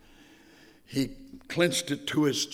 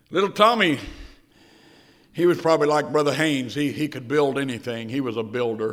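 storyteller opening a parable; story narration; 5 dB louder than usual. Acted. Preacher telling the congregation a story, lightly storytelling. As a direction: draw them into the tale.

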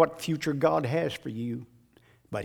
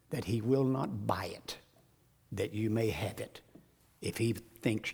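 hushed whisper; 5 semitones lower, 8 dB quieter than usual. Real, not acted. Hushed aside mid sermon.

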